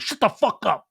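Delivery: acted-out quarrel; retold angry outburst. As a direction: punch each word in mock fury.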